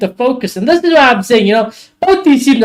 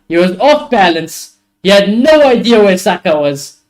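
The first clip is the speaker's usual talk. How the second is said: ordinary talk, nothing marked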